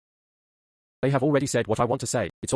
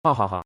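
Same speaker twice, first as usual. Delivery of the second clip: a smug, mocking ha ha ha; gloating laugh; 3 semitones lower than usual. Villain laughing at the ruined rival's loss. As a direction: laugh in his face at his ruin.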